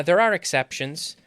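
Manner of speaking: normal talking voice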